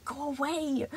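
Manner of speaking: hissing. A narrator's reading.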